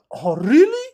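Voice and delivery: high, loud mock disbelief; astonished reaction. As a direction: imitate a gullible, amazed reply, shooting upward. JD's gullible shock at the tale.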